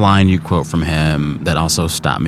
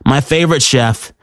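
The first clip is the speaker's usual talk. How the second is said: higher-pitched radio host introduction; host intro; 5 semitones higher than usual. Acted. Host reintroducing the guest to radio listeners, presenter voice.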